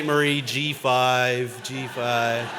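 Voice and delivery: ordinary talk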